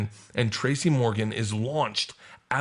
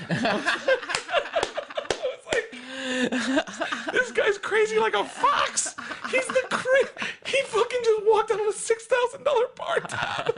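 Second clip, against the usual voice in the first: high, laughing disbelief; laughing while telling; slower than usual. Real, not acted. Comic to hosts about Tracy's escape, laughing high. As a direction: crack up as you tell it.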